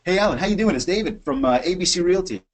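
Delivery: cheerful cold-call opener; upbeat greeting. Shown as a demonstration. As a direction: sound glad to reach him. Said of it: "No, no, let's do it like this".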